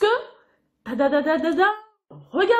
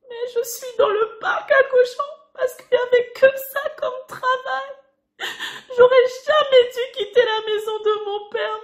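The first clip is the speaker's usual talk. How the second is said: high tearful voice, hammed-up sobbing; mock tearful lament in the pigsty; crying son; 5 semitones higher than usual. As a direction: whimper it like a sorry little boy. Son crying in pig pen.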